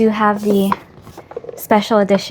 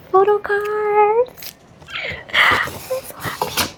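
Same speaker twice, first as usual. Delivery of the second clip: much higher, breathy squeal of joy; breathless squeal; 12 semitones higher, slower than usual. Real, not acted. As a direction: gasp out happiness. Finding the photo card.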